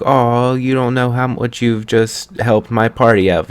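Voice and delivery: a hint of a gushing hostess; lightly imitating a relieved Bulma; grateful Bulma. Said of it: "Bulma's like".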